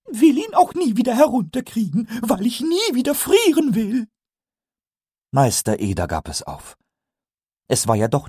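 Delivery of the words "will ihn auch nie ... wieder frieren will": Pumuckl correcting. Acted. Pumuckl corrects Eder, very high and pleased. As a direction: correct him like a smug little kobold.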